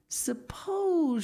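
high, hushed wild worry; wild alarm. A narrator's reading. Miss Pinchin doubts herself before her friends, wild alarm. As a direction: voice a dawning, horrified doubt. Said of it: "looking from one to the other wildly".

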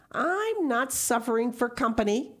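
high, curt retort; short. A narrator's reading. Marilla refuses Matthew's suggestion, short. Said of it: "said Marilla shortly".

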